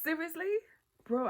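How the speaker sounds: mock-exasperated Seriously, Bro. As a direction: blurt it out fed up and incredulous. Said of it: "sometimes I get so mad".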